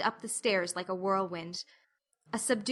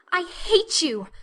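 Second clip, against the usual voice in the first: higher, forced through a choked throat; choked cry; 9 semitones higher, 7 dB louder, slower than usual. A narrator's reading. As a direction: spit it out half-strangled with rage. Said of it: "she cried in a choked voice".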